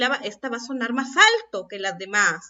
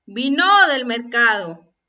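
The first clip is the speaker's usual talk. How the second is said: VINO stressed on the last syllable, loud "no"; wrong final-syllable stress; 6 dB louder than usual. Shown as a demonstration. Teacher reads the misaccented example, "vino" stressed on its last syllable. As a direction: land hard on the end of "vino", like "vi-NÓ". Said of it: "¿Así es como pronunciamos esa palabra?".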